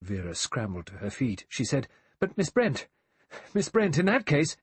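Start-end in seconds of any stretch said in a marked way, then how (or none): 2.2-4.6 high, loud, flustered objection; agitated protest. Acted. Vera scrambling up to challenge Miss Brent, agitated. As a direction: break in urgently, pitched up.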